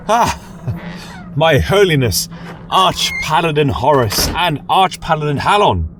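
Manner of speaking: fawning over arriving superiors; flustered nervous laughing. Acted. Flustered reader greets superiors.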